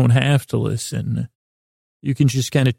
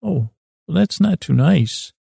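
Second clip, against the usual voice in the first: put-on little boy; 5 semitones higher than usual. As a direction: play a small boy gently objecting. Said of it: "a little kid part of me".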